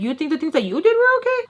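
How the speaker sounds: high, fast accusing retort; retold clash with her father; mock outrage. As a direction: fire the question back, heated. Going off on father.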